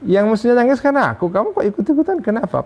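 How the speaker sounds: fast, very high mock outrage; indignant whine. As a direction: climb up in disbelief, I'm the one who should cry. [name] scolds weeping friend.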